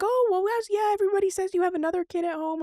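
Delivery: high-pitched, quick chatter; imitated nurse. Other nurse tells patient what everybody says, high-voiced. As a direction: put on a high voice for the staff. Voicing the staff talking to her.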